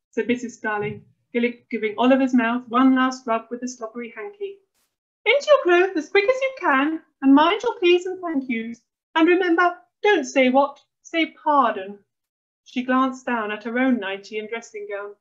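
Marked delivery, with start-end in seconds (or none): 5.3-12.0 high, loud fussing orders; bossy mum. A narrator's reading. Fussy mum orders son to dress.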